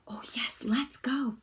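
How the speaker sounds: soft whisper; Annika whispering. A narrator's reading. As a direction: breathe it like a shy little girl. Annika's line in the story.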